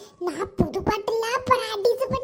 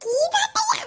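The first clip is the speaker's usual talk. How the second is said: alarmed cry; 12 semitones higher, 3 dB louder, slower than usual. Acted. Alarmed at telling Geetha.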